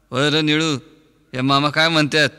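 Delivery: ordinary talk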